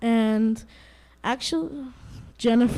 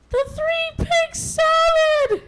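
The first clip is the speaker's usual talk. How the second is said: very high, slow wailing exclamation; exclaiming mother wolf; 5 dB louder, much slower than usual. Acted. Mother wolf finding her dinner ruined, high wailing exclamation. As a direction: shriek it out like a dismayed mother. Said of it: "exclaimed Mother Wolf".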